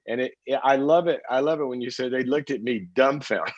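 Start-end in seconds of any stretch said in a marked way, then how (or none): none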